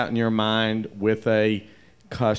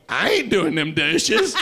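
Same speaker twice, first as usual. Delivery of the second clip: laughing, high refusal; stubborn dishwasher digging in; defiant refusal; 7 semitones higher than usual. Retold or imitated. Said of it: "Ken is standing ... dishes going".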